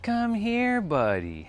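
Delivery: a hint of the neighbor's sing-song call; imitating a neighbor. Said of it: "That's how that guy speaks".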